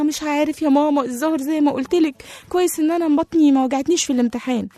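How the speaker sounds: put-on a small boy answering mom; lightly voiced boy. A narrator's reading. Sick boy answers mother. (صوت طفل قلق خفيف)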